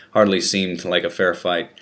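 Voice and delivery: usual speaking voice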